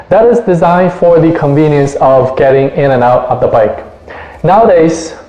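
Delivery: usual speaking voice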